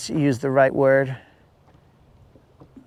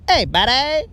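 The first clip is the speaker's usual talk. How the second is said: imitating Colin; 9 semitones higher than usual. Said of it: "if we heard Colin's voice".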